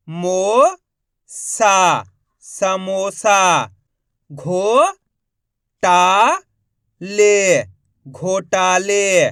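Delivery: long drawn syllables with pauses between; stretched spelling drill; syllable-by-syllable sounding out. Shown as a demonstration. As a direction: sound out each syllable slowly, then blend the word. Teacher sounding out words by syllable.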